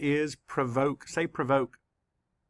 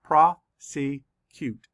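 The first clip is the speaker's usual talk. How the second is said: slow, each syllable pulled apart; 6 dB louder, much slower than usual. Shown as a demonstration. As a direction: sound out each syllable slowly. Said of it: "Let's count the syllables".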